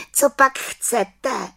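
high, slow little witch. Acted. Little witch asks the lost children, high voice. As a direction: ask in a high storybook witch voice.

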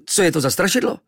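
high, loud cry for help; terrified boy yelping; panicked outburst. Acted. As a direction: blurt it out in fright. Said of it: "Vyrazil jsem ze sebe".

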